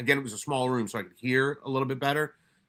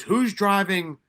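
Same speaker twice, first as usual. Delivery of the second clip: mock instructor yell; 4 semitones higher, 4 dB louder, slower than usual. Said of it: "they start yelling and screaming".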